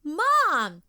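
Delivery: high, loud cry for Mom; yelled. A narrator's reading. Katie recognises her mom at the closet, high loud yell. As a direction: call out in alarm. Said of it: "yelled Katie".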